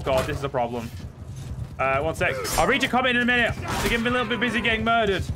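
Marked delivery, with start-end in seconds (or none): 2.6-5.2 frantic excitement. Real, not acted. Streamer telling chat his troops are being slaughtered, frantic. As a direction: hurry through it as chaos erupts. Troops dying during battle.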